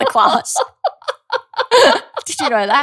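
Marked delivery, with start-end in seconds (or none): 0.6-2.2 loud, high-pitched laugh; high burst of laughter. Real, not acted. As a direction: crack up laughing. Laughing at own story.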